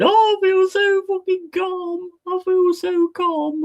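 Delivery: high mock falsetto calm; mocking calm viewer. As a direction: squeak it out mocking a blissed-out viewer. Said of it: "people in the chat going".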